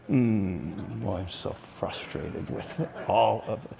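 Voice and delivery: low, slow, muttered discontent; mock grumbling murmur. Shown as a demonstration. Preacher demonstrates grumbling to the congregation, low slow muttering. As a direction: drone it like a low constant murmur. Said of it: "Grumble means to murmur or mutter with muffled undertones".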